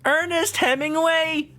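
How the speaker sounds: high, slow disbelief; can't believe the name; incredulous. Real, not acted.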